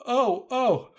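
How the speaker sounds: startled by a blast; frightened cry. Acted. Alice caught in the shockwave, crying out in fright.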